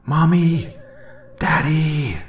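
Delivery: put-on high, loud, scared little boy's cry; frightened child shouting. A narrator's reading. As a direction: call out for your parents like a terrified small child. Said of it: "small, frightened voice shouting".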